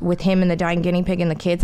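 ordinary talk, nothing marked

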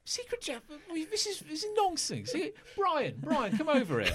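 high, rushed flustered guard voice. Acted. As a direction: squeak it out like a panicky guard.

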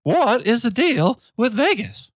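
high, slow Seinfeld-style comic delivery; drawn-out observational comic voice; Jerry Seinfeld impression. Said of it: "my Jerry Seinfeld impression".

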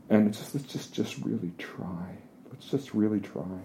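hushed, tender plea; soft pleading. Retold or imitated. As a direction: beg her to stay, almost under the breath. Pleading with girlfriend on dunes.

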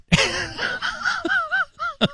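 real high-pitched laughter breaking through; host cracking up on air, laughing. Real, not acted. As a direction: lose it, laugh helplessly. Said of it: "I'm laughing".

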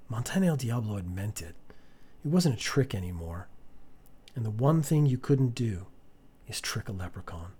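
usual speaking voice

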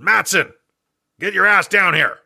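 mock Texan boss with a thick Texan drawl. Client calls the young marketer in with a Texan drawl. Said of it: "I remember he had a Texan drawl".

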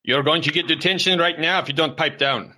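high-pitched mock scolding; angry father. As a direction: warn him like a fed-up dad.

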